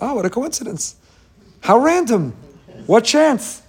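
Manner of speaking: high-pitched mock marvelling; exaggerated high exclamations of surprise; mock amazement. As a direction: play someone wowed by mere chance. Voicing the dismissive reaction he rejects.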